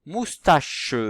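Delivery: MOUSTACHE high, loud, final -che held; teacher's emphatic, lengthened MOUSTACHE; sounding out. Shown as a demonstration. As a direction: stretch the end of MOUSTACHE for listening. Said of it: "est-ce qu'on entend".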